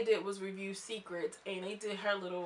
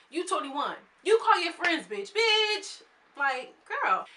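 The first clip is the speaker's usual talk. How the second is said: exasperated outburst; 9 semitones higher, 8 dB louder, slower than usual. Real, not acted. Ranting at cast member's hypocrisy.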